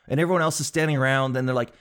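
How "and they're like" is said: ordinary delivery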